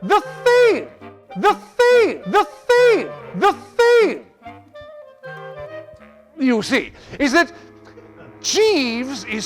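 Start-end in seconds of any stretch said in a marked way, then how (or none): 0.0-4.2 high, loud, repeated outburst; comic fluster. Acted. Gentleman stuck explaining his dependence on Jeeves, flustered. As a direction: stick on the words, leaping high then falling each time.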